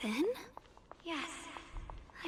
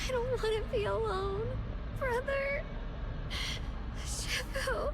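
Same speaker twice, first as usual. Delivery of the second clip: higher, breaking cries for help; desperate cry; 6 semitones higher, slower than usual. Acted. Character losing her senses calls for her brother, crying out. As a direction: plead into the dark, voice cracking.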